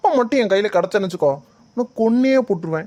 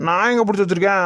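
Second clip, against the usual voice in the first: ordinary delivery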